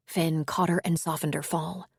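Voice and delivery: ordinary delivery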